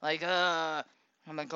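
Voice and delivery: normal talking voice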